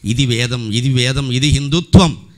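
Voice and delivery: loud, ringing declaration; preaching shout. Real, not acted. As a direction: proclaim it to the whole hall. Preacher exclaims about Veda.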